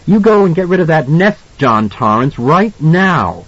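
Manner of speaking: high, cross order; angry wife laying down the law; angry snap. A narrator's reading. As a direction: order your husband sharply. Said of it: "she snapped at him angrily".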